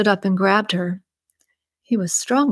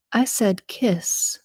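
slow stern whisper; 3 dB quieter, much slower than usual. Acted. Jane commands Randy.

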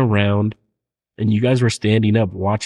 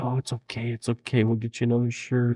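soft, gentle mom voice, much quieter; soothing mom; 2 semitones higher, 8 dB quieter than usual. Retold or imitated. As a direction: comfort a crying child, softly. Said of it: "Your mom's like".

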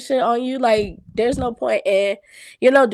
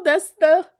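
usual speaking voice

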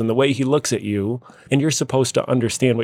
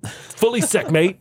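much higher Aussie accent; Australian accent; 6 semitones higher, slower than usual. Shown as a demonstration. Host repeats slang for the quiz, high Australian accent. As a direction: pitch it up in playful Australian. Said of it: "See if we can understand some Australian".

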